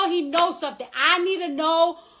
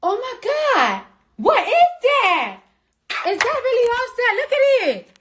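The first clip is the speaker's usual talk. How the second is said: higher, startled disbelief; shocked; 7 semitones higher than usual. Real, not acted. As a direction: gasp it out, alarmed. Startled by his phone lighting up.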